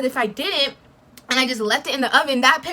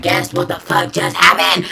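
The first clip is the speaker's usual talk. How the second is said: loud, heated rant to mom; furious rant; 6 semitones lower, 7 dB louder than usual. Real, not acted. Calling her mom, a loud furious rant. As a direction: vent like you're still boiling. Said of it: "I'm so mad".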